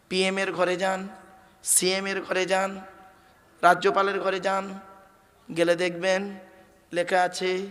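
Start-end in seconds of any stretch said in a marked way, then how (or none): none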